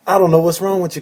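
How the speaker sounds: ordinary delivery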